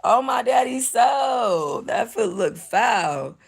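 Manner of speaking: high scream of laughter, then slow disgust; howling laughter, then a drawn-out verdict; shrieking laugh. Real, not acted. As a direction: crack up at the gross foot.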